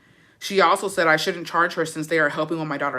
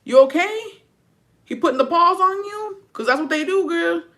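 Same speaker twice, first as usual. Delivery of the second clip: much higher, a touch louder, checking on her; concerned girl-to-girl check-in; 11 semitones higher, 4 dB louder than usual. Retold or imitated. At a party, asking the Guerrero man's girl if she's okay, in a high worried voice. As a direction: lean in and ask a girl at a party if she's safe. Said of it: "And I look at the girl".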